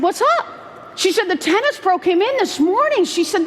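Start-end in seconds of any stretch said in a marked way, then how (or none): 0.0-0.5 very high, rising query; mock-casual high question; innocent curiosity. Plays innocent to manager's news. 1.3-3.0 high-pitched excited report; animated, raised-pitch retelling; retold excited news. Manager breaks amazing news.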